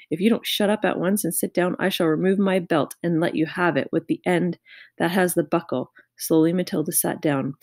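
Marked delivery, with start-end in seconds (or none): none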